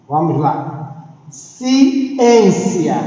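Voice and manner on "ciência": "Ciência" pulled apart, the ÊN higher and drawn out; stressed syllable. Shown as a demonstration. Teacher showing pupils the tonic syllable of "Ciência", ÊN stressed. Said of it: "sairá com um tom maior em relação às outras".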